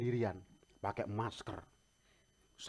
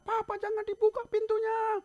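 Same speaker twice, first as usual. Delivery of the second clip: very high imitated kid's cry; squeaky mock child's yell; 4 dB louder than usual. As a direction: call out like an alarmed little child. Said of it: "anak saya teriak".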